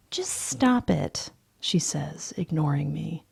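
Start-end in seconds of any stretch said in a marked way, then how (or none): 0.1-1.3 slow, higher woman's voice; a hint of a weary girlfriend; lightly voiced woman. Girlfriend telling him to stop, in a slow higher voice.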